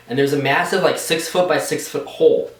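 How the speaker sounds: ordinary delivery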